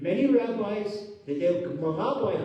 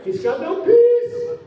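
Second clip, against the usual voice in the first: high, loud, slow exclaim; exasperated; 10 semitones higher, 9 dB louder, slower than usual. Acted. Preacher crying out that the rich man has no peace. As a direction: throw up your hands at it.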